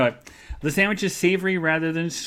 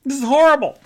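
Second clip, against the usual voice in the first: much higher, louder dismayed complaint; friend complaining; 12 semitones higher, 7 dB louder than usual. Retold or imitated. Retelling her friend's reaction to the hard bed, high dismayed voice. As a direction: play the friend lying on a rock-hard bed. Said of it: "she said".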